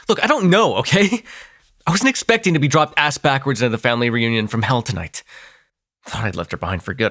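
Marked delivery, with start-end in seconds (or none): 0.1-3.6 rattled outburst. Acted. Defensive, rattled.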